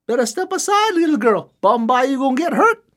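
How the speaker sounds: put-on high, teasing call to the girl; cocky chief calling out. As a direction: tease her from the hilltop. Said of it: "Ahua look at this girl, say".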